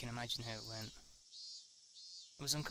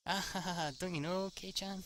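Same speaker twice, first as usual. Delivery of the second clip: higher and louder, laughing him off; mocking laugh; 6 semitones higher, 3 dB louder than usual. Mion laughs off Keiichi's question, mocking. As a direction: laugh at how clueless he is.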